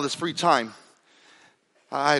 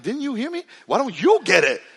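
incredulous; 6 semitones higher than usual. Retold or imitated. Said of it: "I'm like".

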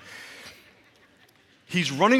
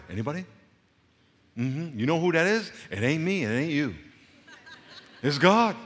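usual speaking voice

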